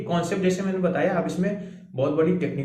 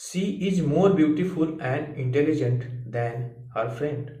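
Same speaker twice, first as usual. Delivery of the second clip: slow dictation; much slower than usual. Shown as a demonstration. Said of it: "और देखो next".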